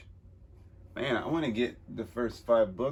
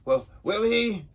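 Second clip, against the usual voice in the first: taunting villain; 12 semitones higher, 10 dB louder than usual. Acted. Voiced villain line.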